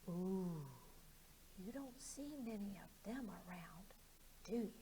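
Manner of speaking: scared frog whispering through pursed lips; frightened pursed-lip whisper; tiny-mouthed frog. Acted. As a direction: squeeze the mouth small and whisper. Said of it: "puckered his lips and made his mouth as small as possible".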